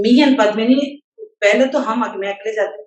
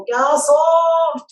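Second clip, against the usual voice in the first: normal talking voice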